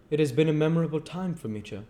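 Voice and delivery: put-on sincere young man; young Pip voice. Pip speaks to Joe.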